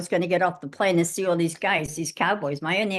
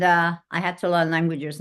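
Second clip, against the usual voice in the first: normal talking voice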